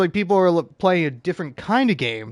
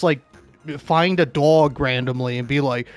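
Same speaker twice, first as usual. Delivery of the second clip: normal talking voice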